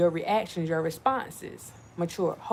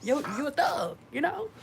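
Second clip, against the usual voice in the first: teasing; 8 semitones higher than usual. Retold or imitated. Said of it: "I'm like".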